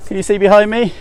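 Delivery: puffing between words, out of breath; winded from the steep climb. Real, not acted. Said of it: "you're gonna hear me huffing and puffing".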